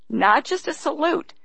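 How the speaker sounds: ordinary delivery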